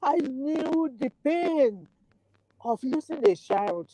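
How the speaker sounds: high voice breaking with grief; tearful, choking up; crying, worn out. Real, not acted. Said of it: "I can't stop crying".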